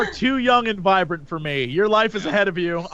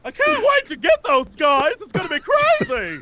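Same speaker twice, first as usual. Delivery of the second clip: high character voice; 8 semitones higher than usual. Pretend. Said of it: "I'm just gonna be like".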